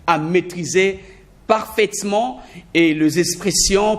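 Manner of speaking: ordinary delivery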